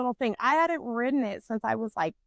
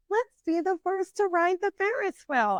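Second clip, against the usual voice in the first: higher-pitched mock-eager group suggestion; eager friends, mimicked; 8 semitones higher than usual. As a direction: chirp it like keen friends, lightly mocking.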